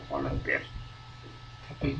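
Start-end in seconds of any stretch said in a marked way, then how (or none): 0.1-0.6 VOLUNTEER said "kolunteer" or "folunteer", much lower and softer; wrong reading. Shown as a demonstration. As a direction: mistaken VOLUNTEER without the buzzing V, dropped low. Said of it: "Bukan volunteer". (VOLUNTEER versi salah, rendah dan pelan)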